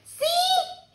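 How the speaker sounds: put-on high, squeaky little kid; toddler voice. Toddler showing his turtle to a guest, high and eager. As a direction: pipe it up like a proud toddler. Fudge shows turtle again.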